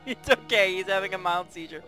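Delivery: ordinary talk, nothing marked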